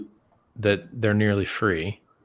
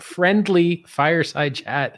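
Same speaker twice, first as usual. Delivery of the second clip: slow, higher "friendly fireside chat" with weight on "friendly"; "friendly" stressed, said slowly and higher, with scorn; sarcastic stress on friendly; 7 semitones higher, slower than usual. Shown as a demonstration. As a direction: lean hard on "friendly", drawing the phrase out slowly. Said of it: "Emphasis on the word friendly".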